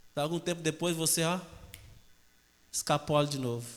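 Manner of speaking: normal talking voice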